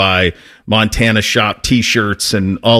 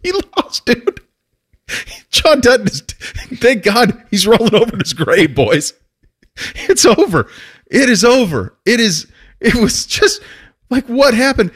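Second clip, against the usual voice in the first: excited; 12 semitones higher than usual. Real, not acted. Excited rant about the town changing.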